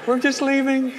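high-pitched, meek getaway line; nervous excuse. Retold or imitated. Father backing away from the huge biker, mock-nervous high voice. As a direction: excuse yourself timidly and get out.

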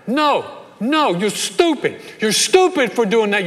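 high, loud family squabble; yelling relatives. Retold or imitated. New York Italian in-laws yelling at one another round the table, mock yelling. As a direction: yell it across the table in outrage. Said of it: "they begin to yell at one another".

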